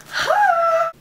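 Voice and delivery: high sharp delighted gasp; excited gasp. Real, not acted.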